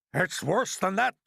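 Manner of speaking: high, loud and drawn out; alarmed cry. A narrator's reading. Police chief topping the fire chief's horror, crying out. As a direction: cry out dire news. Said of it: "cried the chief of police".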